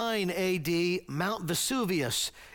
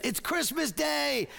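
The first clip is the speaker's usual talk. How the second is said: high-pitched imitation of a British boy; playful attempt at a British child's accent; little British boy accent; 8 semitones higher than usual. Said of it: "my best little British boy accent".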